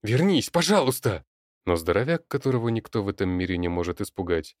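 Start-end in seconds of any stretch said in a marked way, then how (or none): 0.0-1.2 high strained plea; desperate pleading shout. Acted. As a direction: beg him to come back. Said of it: "надрывно кричал он".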